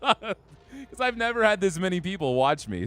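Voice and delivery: giggling through the words; nervous laughing. Real, not acted. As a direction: laugh while you say it, giddy. Laugh heard on lines.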